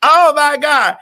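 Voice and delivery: normal talking voice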